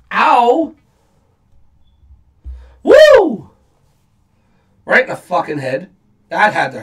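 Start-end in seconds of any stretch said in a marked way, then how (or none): 2.8-3.5 high, loud Flair-style woo; wrestling woo. Real, not acted. Reacting to a brutal chair shot, loud high woo. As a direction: let out a shrill celebratory woo.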